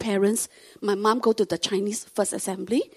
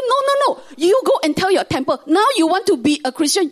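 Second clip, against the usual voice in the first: high, louder insistent urging; urgent pleading; 7 semitones higher, 6 dB louder than usual. Retold or imitated. Daughter urges her father to quit the temple, high and insistent. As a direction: press hard, pitched up and loud.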